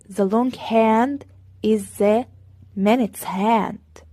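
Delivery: very slow, drawn-out teacher demo; deliberate classroom pacing; slow model reading. Shown as a demonstration. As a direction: stretch each word for the class. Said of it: "teacher models English slowly".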